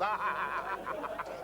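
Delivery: high bursts of cackling; uncontrolled laughter. Acted. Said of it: "He can't control himself".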